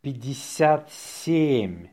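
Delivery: slow, drawn-out dictation. Shown as a demonstration. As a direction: say it slowly for learners. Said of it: "say it slowly".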